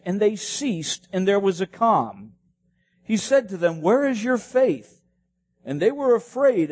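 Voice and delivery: fervent preaching. Acted. Preaching rising to full force.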